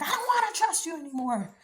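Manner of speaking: imitating a worn-out believer who's had enough; fed-up outburst. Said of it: "you're gonna be like".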